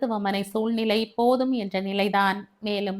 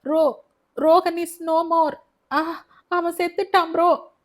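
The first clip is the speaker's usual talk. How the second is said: anguished grief; 8 semitones higher, 5 dB louder than usual. Acted. Younger brother telling his elder brother his friend died, anguished. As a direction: force out the terrible news, voice cracking. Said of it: "குரல் வேதனை முட்களின் கீறல்கள்".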